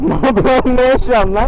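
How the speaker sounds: high incredulous yell; laughing shout. Real, not acted. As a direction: shout in amused disbelief. Shouting at friend's stunt.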